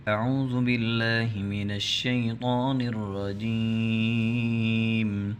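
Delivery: slow, chanted tilawat with long held vowels; Quranic recitation. Acted. Recitation before the Quran lesson. (قرآنی تلاوت کا انداز)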